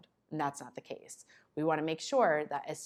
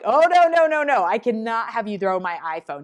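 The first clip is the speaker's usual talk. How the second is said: much higher and much louder, frantic; panicked plea; 4 semitones higher, 12 dB louder than usual. Retold or imitated. Teacher acting a panicked adult begging the kid not to throw the phone. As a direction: shriek as the phone is about to be thrown. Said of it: "If I'm like".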